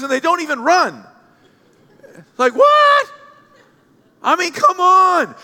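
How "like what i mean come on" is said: high-pitched loud disbelief; shocked mock outrage; incredulous. Real, not acted. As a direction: react like it's absurd. Pastor mock outrage at shoe stat.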